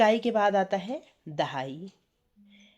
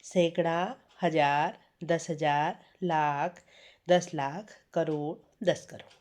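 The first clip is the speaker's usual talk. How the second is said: dictating slowly; 4 semitones lower, slower than usual. Shown as a demonstration. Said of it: "तो आप लिख लीजिए इसको".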